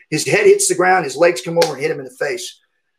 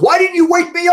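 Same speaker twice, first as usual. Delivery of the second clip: much higher, louder indignant cry; dad protesting loudly that he missed the fight; 12 semitones higher, 5 dB louder than usual. Retold or imitated. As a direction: play the dad, outraged he was left asleep.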